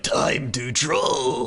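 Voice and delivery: very slow, drawn-out troll shout; troll battle cry. Acted. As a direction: bellow it like a game troll. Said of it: "he always be like".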